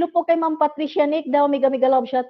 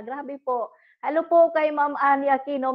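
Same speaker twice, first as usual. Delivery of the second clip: ordinary talk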